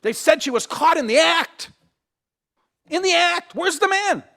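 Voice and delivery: preacher rising to a point; pressing point. Real, not acted. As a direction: build the indignation. Preacher presses rhetorical question.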